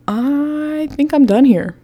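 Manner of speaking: high, drawn-out hesitant start; awkward backing out. Retold or imitated. Police officer excusing himself from family fight, awkwardly hesitant. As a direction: stretch it out high, edging away.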